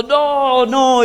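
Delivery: high, stretched-out cries; imitated screaming. Preacher acts out the boy held by his relatives, imitated high screaming. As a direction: mimic a possessed boy's high wail. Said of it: "y estaba gritando".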